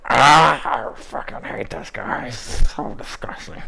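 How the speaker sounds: groan, then a slow voiceless rasp of loathing; disgusted character voice. Shown as a demonstration. Demonstrating one of the character voices.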